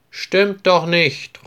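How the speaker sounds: put-on a boy objecting to his classmate's boast; protesting call. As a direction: call out that he is wrong, slowly. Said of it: "ruft Peter".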